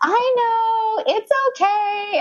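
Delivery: very high, cooing imitation; hammed-up high-pitched reassurance; soothing doctor. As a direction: placate an angry patient in a high voice. Doctor soothing the yelling patient.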